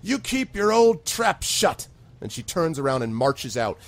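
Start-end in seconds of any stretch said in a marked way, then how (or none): 0.0-1.9 put-on higher, slow, louder scolding old woman; slow stern command. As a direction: spit it out slowly at him. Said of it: "she looks at him".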